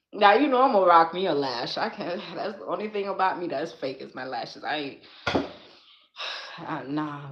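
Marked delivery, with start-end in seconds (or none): none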